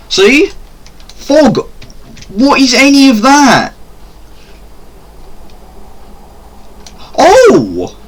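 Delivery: high frightened yelps; scared shriek. Real, not acted. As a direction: shriek as the fog spooks you. Scared by fog in game.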